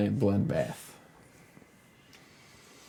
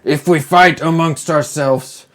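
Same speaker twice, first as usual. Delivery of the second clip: loud, raised giant's proclamation; commanding attention; 4 semitones higher, 9 dB louder than usual. Acted. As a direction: boom it out over the fighting crowd. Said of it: "to get everybody's attention".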